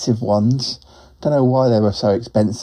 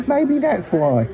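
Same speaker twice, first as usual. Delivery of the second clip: high-pitched, slow laughing aside; amused chuckling; 11 semitones higher, slower than usual. Pretend. Laughs at own quacking mishap.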